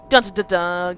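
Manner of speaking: loud vocal "dun dun dun dun" suspense sting; punchy mock-ominous dun-duns; mock dramatic music sting. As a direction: sing out a soap-opera cliffhanger sting.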